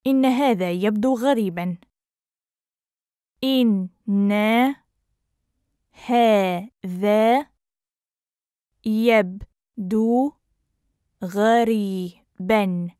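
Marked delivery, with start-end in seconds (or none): 3.4-12.9 very slow, broken into separate syllables. Shown as a demonstration. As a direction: sound it out with long gaps. Teacher breaks phrase into syllables.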